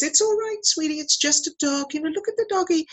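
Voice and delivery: put-on a mother calming her startled baby, high and sweet; soothing mum. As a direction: coo to a crying toddler. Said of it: "His mum says".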